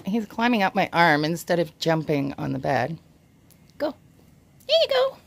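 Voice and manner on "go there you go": high, louder coo to a pet; pet talk. Real, not acted. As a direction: cheer a little animal on in a high voice. Cheering on pet iguana on bed.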